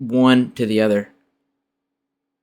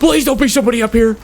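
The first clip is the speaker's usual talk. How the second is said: panicked; 9 semitones higher, 4 dB louder, much faster than usual. Real, not acted. Panicked in-game plea.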